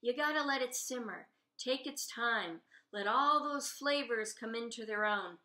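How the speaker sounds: plain reading voice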